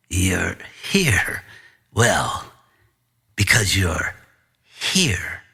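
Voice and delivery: a hint of a low, sinister principal; low and unhurried, toying with the boy; lightly voiced principal. Principal answering the confused student, lightly sinister. Principal Doctor Vine speaking.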